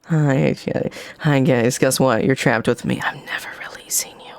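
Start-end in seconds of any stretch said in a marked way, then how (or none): 3.0-4.3 whisper. Pretend. Teasing viewers trapped.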